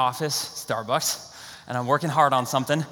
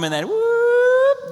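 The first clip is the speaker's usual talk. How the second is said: whoop; 5 dB louder, much slower than usual. Retold or imitated. Guy dropped through the roof.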